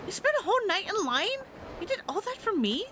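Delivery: put-on a touched girlfriend who can't believe it.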